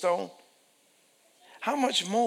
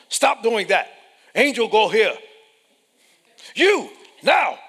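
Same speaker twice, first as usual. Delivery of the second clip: loud, high barked orders; stern ruler God; 7 semitones higher, 12 dB louder than usual. Acted. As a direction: command angels sharply from the throne. God imagined bossing on throne.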